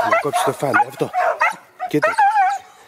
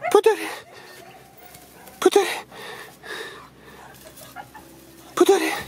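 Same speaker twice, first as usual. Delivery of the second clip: high-pitched call. Real, not acted. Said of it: "την ψηλή, σε ψηλή συχνότητα φωνή".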